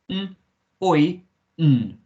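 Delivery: "N, oi, n" with gaps between sounds; isolated hum, "oi", final hum; sounded out. Shown as a demonstration. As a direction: split neun into "N, oi, n". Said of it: "make the oi sound".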